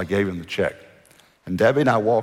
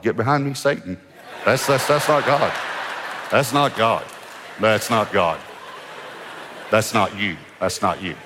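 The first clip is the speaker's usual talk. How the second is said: mock rebuke. Rebuking the thought as Satan.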